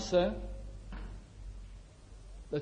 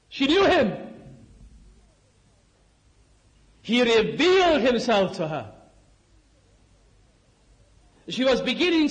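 raised, ringing preacher's delivery with stretched key words; full-force preaching; 3 semitones higher than usual. Acted. Preacher proclaiming the moment of revelation to the congregation, impassioned.

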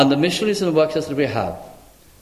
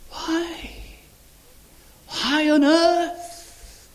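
hushed high pleading, slow and drawn out; pleading voice of God; 5 semitones higher, much slower than usual. Acted. God asking his church why, hushed then crying out high. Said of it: "I wonder what God has to say".

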